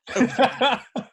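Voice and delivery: loud, high-pitched laugh; burst of laughter. Real, not acted. Agreeing it has happened often, loud laugh.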